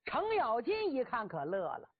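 plain reading voice

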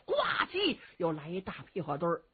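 usual speaking voice

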